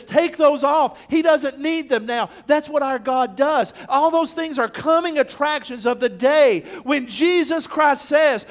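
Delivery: loud, high, driving sermon climax; full-force preaching. Acted. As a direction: preach it out to the whole congregation.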